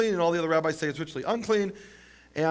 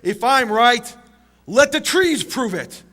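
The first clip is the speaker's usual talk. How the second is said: louder, higher retold shout; shouted plea; 5 semitones higher, 7 dB louder, slower than usual. Rabbi shouting his challenge to the academy, loud and high. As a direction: call out to the heavens. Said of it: "Rabbi Eliezer shouts out".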